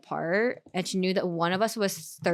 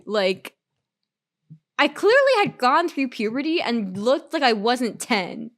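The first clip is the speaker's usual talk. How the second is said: usual speaking voice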